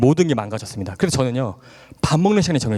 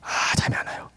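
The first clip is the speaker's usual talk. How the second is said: whispered, drained complaint; whispered sigh; 6 dB quieter than usual. Retold or imitated. 잠 못 드는 밤 혼자 중얼거리는 화자, whispered lament. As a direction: breathe it out in a whisper. Can't sleep, repeats line.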